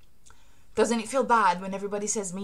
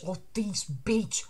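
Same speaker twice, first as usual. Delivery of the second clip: muttered scornfully; 8 dB quieter, slower than usual. Pretend. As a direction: say it under your breath.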